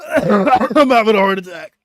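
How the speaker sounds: loud, high, strained after coughing; choking. Real, not acted. Streamer choking in front of the chat, strained. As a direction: force the words out through a coughing fit. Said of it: "Or I'm choking to death".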